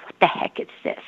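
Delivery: a hushed what-is-this; whispered disbelief. Retold or imitated. First reading about law of attraction, whispered. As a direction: whisper it, puzzled. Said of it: "I'm like".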